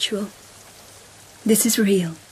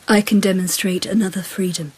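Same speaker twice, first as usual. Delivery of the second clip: ordinary delivery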